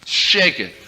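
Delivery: usual speaking voice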